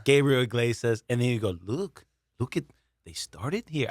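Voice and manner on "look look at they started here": a hushed, put-on take on Jack Senior's pitch; Jack Senior impression. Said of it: "I don't know how to do a Jack Senior".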